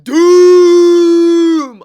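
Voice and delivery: very loud, high, sustained bellow; hyped reaction yell. Shown as a demonstration. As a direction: hold one huge booming cry. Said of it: "when I see people get whooped".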